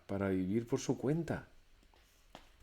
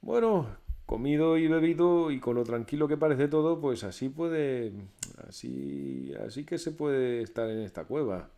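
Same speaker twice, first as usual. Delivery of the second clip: pleased, higher and louder musing; contented Pedro; 5 semitones higher, 6 dB louder than usual. A narrator's reading. Well-fed Pedro talks himself into staying, pleased. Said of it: "se dijo".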